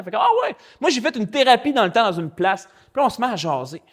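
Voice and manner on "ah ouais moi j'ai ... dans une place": a high, surprised burst, then chatty; light up at hearing he's a pastor; excited exclamation. Retold or imitated. Serge learning the man is a pastor, high excited burst.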